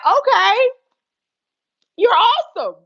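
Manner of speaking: high, loud okay; delighted and impressed; impressed manager. Retold or imitated. Manager praising the bartender, loud excited approval.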